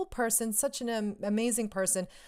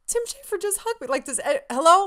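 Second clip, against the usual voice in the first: high, incredulous outburst getting louder; overwhelmed excitement; 10 semitones higher, 7 dB louder than usual. Retold or imitated. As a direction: blurt it out in giddy disbelief. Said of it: "so overwhelmed with happiness and excitement".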